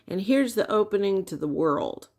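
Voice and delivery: normal talking voice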